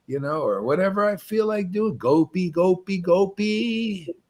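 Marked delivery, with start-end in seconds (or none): 2.0-4.1 slow, chant-like repetition; savoured, drawn-out chanting; relishing the name. Shown as a demonstration. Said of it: "relish the name".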